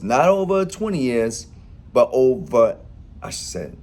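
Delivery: ordinary talk, nothing marked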